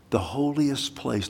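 ordinary talk

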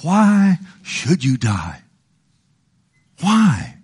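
slow, drawn-out pleading question; pleading as God. Retold or imitated. God asking the wicked to turn, slow imitated plea. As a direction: stretch each word out, imploring. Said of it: "listen to what God says".

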